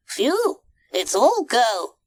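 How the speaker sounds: high-pitched, busy little character; high cartoon monkey voice; monkey first mate. A narrator's reading. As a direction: puff it out in a high voice. Mike the monkey.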